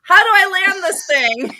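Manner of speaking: high, loud panicky question; a clumsy angel unsure how to land; flustered flyer. Retold or imitated. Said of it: "He's like".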